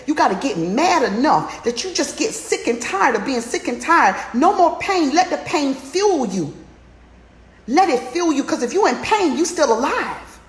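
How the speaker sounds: high, loud rallying cry; soaring motivational shout; fired-up preaching. Real, not acted. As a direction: preach it at full force, lifting the room. Rousing climax of motivational talk.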